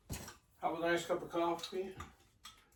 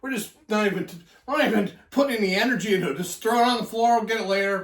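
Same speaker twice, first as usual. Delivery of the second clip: louder, faster hammed-up carelessness; exaggerated lazy joking; 7 semitones higher, 10 dB louder, faster than usual. Real, not acted. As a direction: play up not caring, big and fast. Joking about tossing trash.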